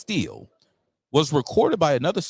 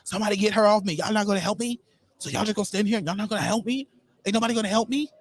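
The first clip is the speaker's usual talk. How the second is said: high pleading voice begging for help; mimicking the teacher; 11 semitones higher, faster than usual. Host imitating the teacher begging students for help, high and pleading. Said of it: "What she said was".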